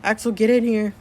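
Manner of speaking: loud call into the next room. Acted. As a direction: shout for him to come in. Mother calling son from another room.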